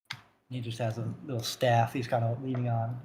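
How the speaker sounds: ordinary talk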